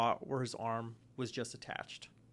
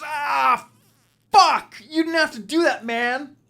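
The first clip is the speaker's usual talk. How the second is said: higher, much louder pained yelling; agonized shout; 10 semitones higher, 12 dB louder than usual. Acted. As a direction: scream at the executioner in pain. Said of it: "He goes".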